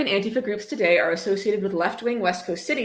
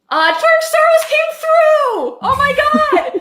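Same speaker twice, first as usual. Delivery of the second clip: much higher, louder, slow drawn-out joke cheer; hammed-up jackpot scream; mock ecstatic shout; 11 dB louder, much slower than usual. Mock joy at Soros money.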